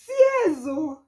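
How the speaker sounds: high, loud mock-crying wail; tearful wail. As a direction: wail it out in hammed-up sobs. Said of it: "actual tears were pouring out".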